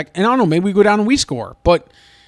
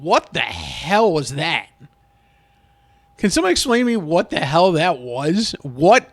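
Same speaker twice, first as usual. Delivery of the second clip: exasperated. Real, not acted. Exasperated fan after failed play.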